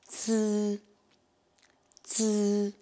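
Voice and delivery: "zi" as dz with a held buzz instead of i. Shown as a demonstration. Teacher demonstrates Z I, "zi" held with no clear i. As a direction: model "zi" drawn out. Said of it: "Et le Z I".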